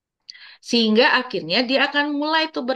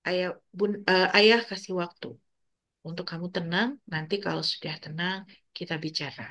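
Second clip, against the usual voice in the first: much softer, calm and steady; calm firm; 3 semitones lower, 6 dB quieter than usual. Shown as a demonstration. Father calming fighting children, soft steady firmness. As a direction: give them time, quietly and firmly. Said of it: "Itu tegas".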